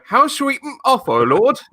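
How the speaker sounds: very high, loud hammed-up follower; mock high-pitched earnest disciple; followers asking. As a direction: squeak it like a devoted disciple. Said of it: "all the followers go".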